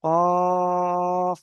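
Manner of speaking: very slow recitation of letter names; drawn-out letter recitation. Shown as a demonstration. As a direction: stretch each letter name long and sung. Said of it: "দেখেছেন?".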